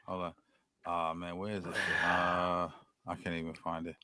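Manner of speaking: tipsy quiet mumble; slurred after drinks. Real, not acted. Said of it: "I'm drunk".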